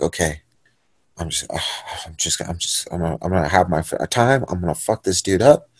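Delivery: very low, near-whispered self-talk; sigh, then mutter the threat softly; hushed resolve. Retold or imitated. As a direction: psych yourself up under your breath. Retold resolve before the fight.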